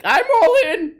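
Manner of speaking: high, squeaky mock voice, dragged out; mock a convoy guy hyping himself up, way up high; mocking protester voice. Mocking a convoy protester in a high squeaky voice. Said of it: "he's like".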